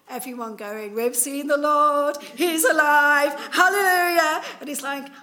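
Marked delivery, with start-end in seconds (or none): 0.9-4.4 loud mock celebration, hallelujah; rejoicing crowd. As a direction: crow it to him over and over. Everyone rejoicing at Thomas.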